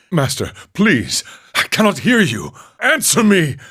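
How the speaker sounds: beg, then shout into the silence; desperate plea building to a shout. Acted. Monk crying out to his master.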